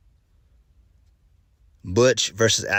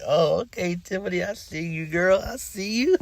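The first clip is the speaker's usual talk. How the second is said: much higher, playful put-on voice hyping her up; sassy hype; 11 semitones higher than usual. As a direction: cheer her on like a sassy friend, pitched way up. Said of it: "I was like".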